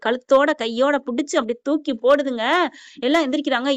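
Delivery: ordinary delivery, nothing marked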